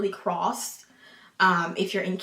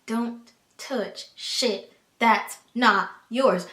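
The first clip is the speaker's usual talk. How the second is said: "don't touch shit that's not yours" slowly, each word apart; word-by-word emphasis; much slower than usual. Real, not acted. Drilling newcomers on "don't touch shit that's not yours", word by word.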